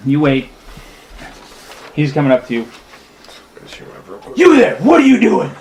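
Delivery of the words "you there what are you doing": loud, high-pitched bandit shout; raised, demanding holler; shouted challenge. Acted. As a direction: call out a stranger from a distance. Bandit challenges traveler on road.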